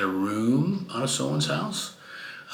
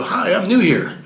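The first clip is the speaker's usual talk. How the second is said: a nervous laugh through the words, higher; 9 semitones higher, 5 dB louder than usual. Retold or imitated. Newcomer laughing as he greets police officers.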